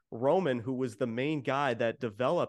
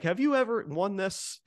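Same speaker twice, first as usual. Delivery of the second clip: mocking taunt; 9 semitones higher than usual. Roman taunting Cody, retold.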